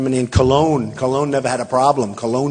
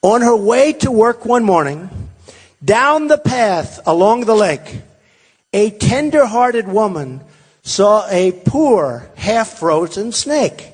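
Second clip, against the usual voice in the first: slow, loud, higher-pitched recitation; big storybook delivery, drawn out; dramatic lyric reading; 4 semitones higher, 4 dB louder, slower than usual. Acted. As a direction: declaim it like a fable, stretching each phrase. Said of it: "I'm gonna read it to you".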